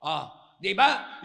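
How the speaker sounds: ordinary talk, nothing marked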